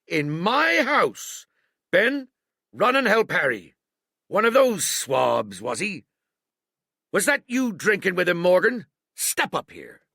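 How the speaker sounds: high, loud barked orders; pirate cook shouting orders at his men; angry old sea cook. A narrator's reading. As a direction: rouse the tavern like an angry landlord. Silver ordering in tavern.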